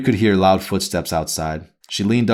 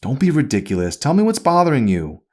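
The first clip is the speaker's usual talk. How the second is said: higher, prying mother questioning her daughter; a nagging mother, pitched up and pressing; mother's voice; 8 semitones higher, 4 dB louder than usual. A narrator's reading.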